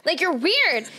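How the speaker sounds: high, insistent mock plea to be left alone; retold protest. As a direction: act out shaking off a pursuer. Retold fending off a pursuing date.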